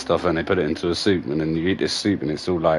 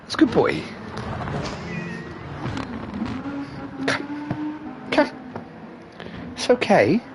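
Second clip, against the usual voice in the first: talking to the dog; 6 dB quieter, slower than usual. Real, not acted. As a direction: coo at the dog like a puppy. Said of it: "talking to his dog".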